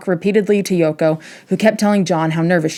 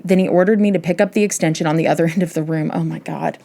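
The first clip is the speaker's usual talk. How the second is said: normal talking voice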